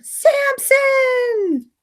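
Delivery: high, long falling call. Acted. Margot yelling for her fallen friend, high call. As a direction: yell his name down the hill. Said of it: "Margot's yelling".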